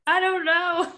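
hammed-up distressed whine; retold whiny meltdown. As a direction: imitate a helpless emotional wreck. Retold meltdown answer to husband.

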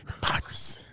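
soft, voiceless whisper; hushed breath-only echo. Acted. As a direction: whisper it back very softly. Whispers the word back.

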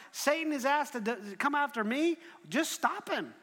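acted urgent appeal; incredulous protest.

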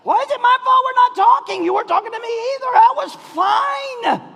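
high, loud, laughing protest; wife objecting to being blamed, high and loud; mock wife's protest. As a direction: play the wounded wife, hammed up. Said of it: "Don't do that".

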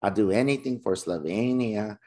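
lightly put-on movie accent; a hint of a movie character's accent on Slovenia; movie quote accent. Co-host teasing in a movie voice, lightly accented. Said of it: "What was that accent?".

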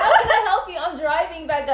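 high, laughing mock exasperation; exasperated panic. Retelling driver snapping back at Becca, laughing exasperation. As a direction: protest in a high voice through laughter.

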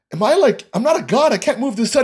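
high-pitched, lightly exasperated protest; incredulous protest. Retold or imitated. Planner kind of laughing at the bride's demand, incredulous protest.

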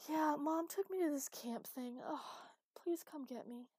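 high-pitched distressed daughter; upset pleading. A narrator's reading. Daughter at a camp begs her father, upset.

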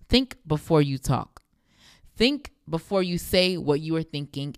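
ordinary talk

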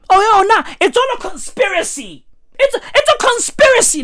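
high, fast "it's a conspiracy"; mocking defender. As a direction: wave it off as a plot.

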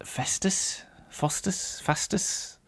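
unsure whispered tries at "Festus", "Faustus", "Fastus"; whispering "Festus", "Faustus", "Fastus" to find the right sound; trying out pronunciations. Shown as a demonstration. Guessing the character's name, trying each in a whisper. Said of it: "Something like that".

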